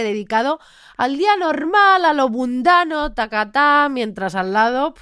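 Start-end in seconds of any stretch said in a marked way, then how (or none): 1.0-3.9 mock sing-song. Mock dreamy mundane day.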